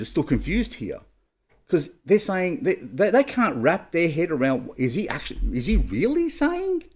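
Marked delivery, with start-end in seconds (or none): 5.6-6.8 pitched way up in disbelief; incredulous high question. Retold or imitated. Pharisees asking Jesus in disbelief, high incredulous voice. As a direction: voice the Pharisees' stunned doubt.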